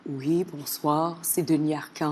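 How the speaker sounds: deep, slow cavernous voice; Denis Arcand cavernous voice. Retold or imitated. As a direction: drop low and slow, cavernous. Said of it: "Avec sa voix caverneuse".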